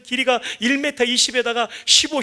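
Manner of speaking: ordinary talk, nothing marked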